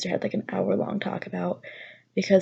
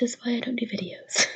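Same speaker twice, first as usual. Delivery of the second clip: softer, breathy self-conscious aside; embarrassed whisper; 4 semitones higher, 5 dB quieter than usual. Real, not acted. Vlogger admitting to viewers why she avoids videos, whispered. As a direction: whisper it as tears catch up.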